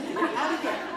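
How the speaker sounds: high, loud comic desperation; mock panic. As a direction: plead to escape, played for laughs. Acted panic to escape the elevator.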